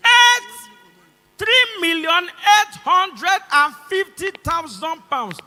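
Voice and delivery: high, loud, slow outcry; incredulous shouting. Real, not acted. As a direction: belt out each part of the sum in disbelief. Outraged repeat of the prize.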